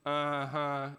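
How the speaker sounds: knowing, unconvinced; skeptical uh-huh. Retold or imitated. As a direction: sound like you see right through it. Skeptical cop mom.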